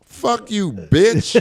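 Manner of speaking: high mock snap at the comic, rising in volume; retold curse-out. Woman in mink coat cursing out the comic, mock angry. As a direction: fire it back like the offended woman. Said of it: "She, she said".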